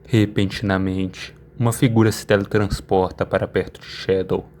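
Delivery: normal talking voice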